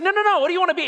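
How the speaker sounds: high, pushy mock shout; badgering, raising the voice; retold insistent shout. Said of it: "getting progressively louder and louder".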